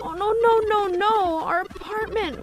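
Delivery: frantic shock at a wrecked home; high, breaking distress over the ransacked apartment; distraught. Acted. Character finds ransacked apartment.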